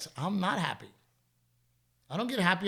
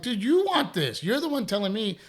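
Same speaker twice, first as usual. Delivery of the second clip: pointed scolding; 7 semitones higher, 4 dB louder than usual. Retold or imitated. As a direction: press him with his own words. Dad retelling scolding son.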